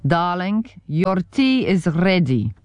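put-on slow, gentle German accent; tender German accent calling upstairs. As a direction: call up the stairs in a German accent. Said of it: "in his heavenly German accent".

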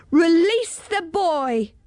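high, slow, commanding order; stern demand. A narrator's reading. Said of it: "demanded in her sternest voice".

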